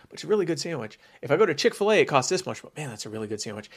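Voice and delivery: ordinary delivery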